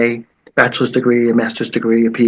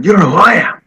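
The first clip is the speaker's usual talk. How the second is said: loud, higher mock shout; retold yell back; 7 semitones higher, 6 dB louder, faster than usual. Referee yelling back at the coach, loud mock shout. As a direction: throw it right back at him. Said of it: "started yelling".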